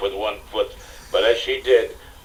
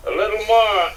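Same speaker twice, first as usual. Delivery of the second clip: gasping; 12 semitones higher, 6 dB louder than usual. Acted. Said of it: "Indy gasped".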